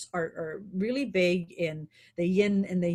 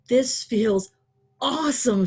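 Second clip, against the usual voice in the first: acted enthusiasm; 4 semitones higher, 7 dB louder than usual. Voices an example good feeling.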